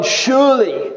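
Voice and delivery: "Surely" pitched high, then falling firmly; conviction on surely. Acted. Preacher citing Psalm thirty-one, assured emphasis on "Surely". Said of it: "words of personal conviction".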